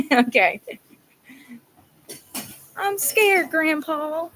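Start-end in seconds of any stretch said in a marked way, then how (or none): 2.7-4.3 high, lightly voiced boy; scared little boy. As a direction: squeak it like a frightened kid. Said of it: "the little boy's like".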